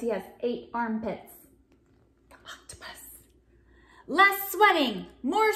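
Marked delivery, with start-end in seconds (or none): 2.3-3.2 breathy whispered answer. Acted. Narrator revealing the octopus, whispered. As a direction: whisper it like a secret.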